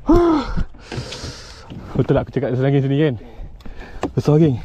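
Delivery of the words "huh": very high, loud whoop; triumphant whoop. Real, not acted. Angler celebrating big catch, triumphant whoop. Whooping after landing fish.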